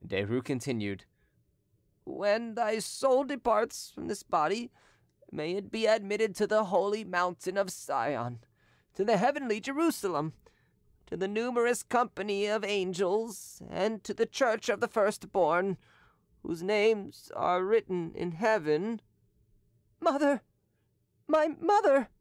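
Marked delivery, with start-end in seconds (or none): 2.0-19.0 hypocrite's solemn litany; solemn prayer recitation. A narrator's reading. Derues reciting prayer for dying. 20.0-22.1 higher, louder cry for his mother; dying boy's anguished cry; desperate cry. A narrator's reading. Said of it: "cried Edouard".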